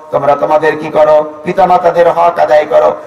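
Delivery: ordinary delivery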